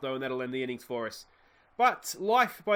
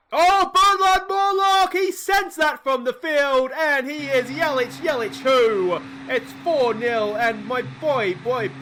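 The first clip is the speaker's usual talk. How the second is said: high, loud, slow triumphant yelling; ecstatic shout; 9 semitones higher, 10 dB louder, slower than usual. Real, not acted. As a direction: bellow it like the game's been won. Big hit celebration commentary.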